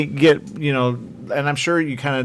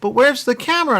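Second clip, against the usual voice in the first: higher-pitched, insistent mock confusion; confused mother; 10 semitones higher than usual. Mother pressing host about the movie, imitated bafflement. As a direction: imitate a mother still not getting it. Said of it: "she literally got so confused".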